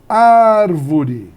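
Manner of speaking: first syllable of Árvore held long and very loud; Árvore with a big drawn-out ÁR; stretched stressed syllable. Shown as a demonstration. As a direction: call out Árvore, punching ÁR. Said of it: "Proparoxítona é a antepenúltima".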